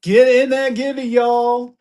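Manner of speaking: high, projected hype voice; hype call-out. Real, not acted. Hyping raid and giveaway to viewers.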